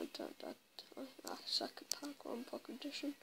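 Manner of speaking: hushed whisper to himself. Real, not acted. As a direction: whisper it under your breath. Whispered aside while playing.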